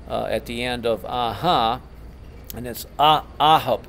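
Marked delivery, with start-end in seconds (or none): 3.0-3.9 "ahop" with AH punched; stress on first syllable. Shown as a demonstration. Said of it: "accent is on the first syllable".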